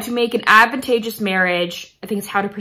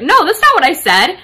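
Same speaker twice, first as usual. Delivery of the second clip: retold Kirk protesting; 8 semitones higher, 8 dB louder than usual. Retelling Kirk's protest.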